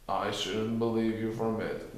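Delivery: grumbling donkey. A narrator's reading. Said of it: "grumbled Eeyore".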